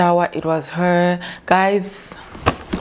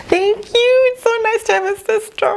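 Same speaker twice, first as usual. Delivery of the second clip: gushing thanks; 13 semitones higher than usual. Real, not acted. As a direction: burst out thanking her sister. Gushing thanks to her sister.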